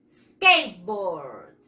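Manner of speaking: SKATEBOARD as two slow English syllables, "skeit-bord", falling low; English pronunciation model. Shown as a demonstration. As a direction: model SKATEBOARD for repeating, drawn out and dropping in pitch. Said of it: "Patineta en inglés".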